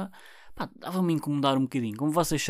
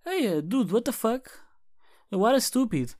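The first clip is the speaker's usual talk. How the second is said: mock confused new listener; 8 semitones higher, slower than usual. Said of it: "pessoal que também depois vier".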